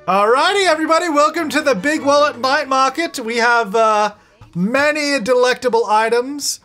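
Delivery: high-pitched showy stallholder welcome; market announcer. Pretend. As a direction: work the crowd like a market barker.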